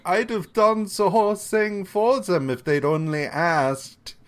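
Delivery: put-on a light German professor accent, pleased with himself; vaguely German accent. Professor Kerwin tells Daredevil he'd have helped, vaguely German accent. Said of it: "vaguely Germanic".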